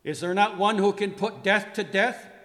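high, loud, demanding question; ringing theatrical challenge. Acted. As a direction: throw the question out forcefully. Repeats the challenge to Death, raised.

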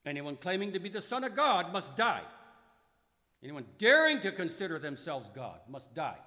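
loud, high angry demand; angry retort. Acted. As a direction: leaders hurl it back at Pilate. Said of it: "responded in kind".

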